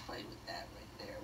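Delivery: soft whisper to herself; whispered aside. Real, not acted. Painting teacher whispers to herself over the canvas. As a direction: breathe it out, half to yourself.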